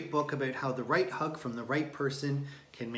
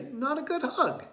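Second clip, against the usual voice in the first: big sister, disappointed; 12 semitones higher, 4 dB louder than usual. A narrator's reading. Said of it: "said Thea".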